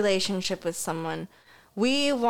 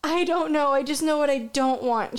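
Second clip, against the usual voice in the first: whiny daughter; 5 semitones higher, faster than usual. Retold or imitated. Mother imitating her hungry daughter's high whine. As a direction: sulk like a hungry kid. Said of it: "daughter's reply in the story".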